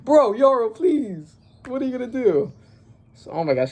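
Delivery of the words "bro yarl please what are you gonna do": desperate plea. Real, not acted. Reader begs character on page.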